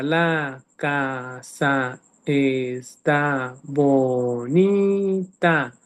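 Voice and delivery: "La casa está bonita" split into slow separate chunks with long gaps; segmented speech. Shown as a demonstration. Speech therapist demonstrating a child's slow segmented speech to colleagues. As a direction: break "La casa está bonita" apart slowly, like a child with apraxia. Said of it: "te está segmentando".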